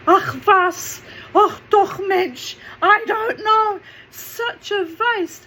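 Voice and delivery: high, loud, slow German-flavoured mum voice; mum exasperated, in German. Retold or imitated. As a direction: sigh it out as a flustered German mother.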